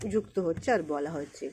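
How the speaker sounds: ordinary talk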